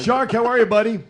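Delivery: loud, high, happy hello; excited greeting. Real, not acted. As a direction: greet a buddy with a big cheer. Greeting caller on the phone.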